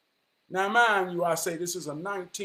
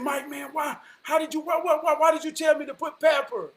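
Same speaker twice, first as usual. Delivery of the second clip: amazed; 9 semitones higher, 3 dB louder than usual. Retold or imitated. Amazed the leak stopped.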